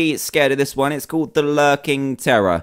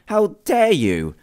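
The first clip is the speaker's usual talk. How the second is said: slow, higher-pitched, hammed-up outrage; mock indignation; 7 semitones higher, much slower than usual. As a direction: stretch out the fake outrage. Bug regenerates health.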